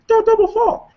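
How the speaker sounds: very high, squeaky cartoon mouse voice; ridiculous funny voice, far above normal pitch; Mickey Mouse. Shown as a demonstration. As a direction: say it in a silly, sky-high falsetto. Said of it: "like a Mickey Mouse voice".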